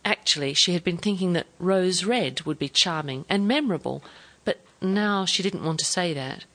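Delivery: usual speaking voice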